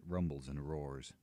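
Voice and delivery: ordinary delivery, nothing marked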